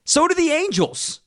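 very high, indignant comeback; squeaky high outrage; incredulous retort. Real, not acted. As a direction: fire it straight back in disbelief.

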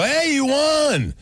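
very high, stretched-out cheer; mock-jubilant radio DJ; DJ winner announcement. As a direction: hype up the contest winner, long and high.

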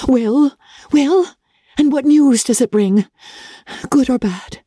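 high, impatient daughter; eager questioning. Acted. Daughter eagerly presses father for news.

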